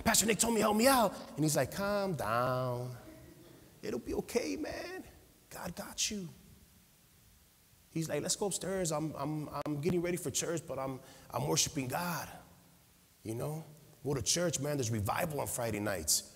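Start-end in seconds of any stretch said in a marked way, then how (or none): 0.0-1.2 panicked plea. Retold or imitated. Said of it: "I'm hysterical". 1.7-16.2 soothing. Retold or imitated. Calm brother soothing panicked man.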